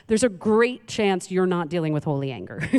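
ordinary talk

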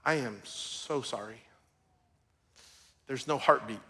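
hushed doctor's sorrowful words; gentle bad news. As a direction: break the news softly and gravely. Doctor delivering news of stillbirth.

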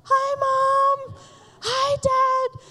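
put-on high, sing-song kid greeting; playful child's hi; waving child. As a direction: call out like a child on stage. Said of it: "we're like".

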